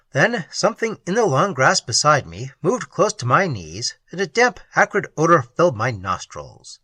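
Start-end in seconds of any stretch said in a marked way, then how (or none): none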